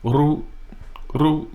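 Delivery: ru with a light tap of the R, high; just touch the R in ru; barely rolled r. Shown as a demonstration. Teacher showing learners how to barely roll the R. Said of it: "barely roll that R".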